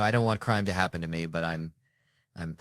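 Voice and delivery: ordinary talk, nothing marked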